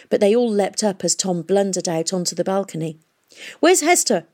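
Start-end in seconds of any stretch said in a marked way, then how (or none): 3.6-4.3 high, loud urgent demand. A narrator's reading. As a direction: insist on an answer now. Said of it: "he demanded".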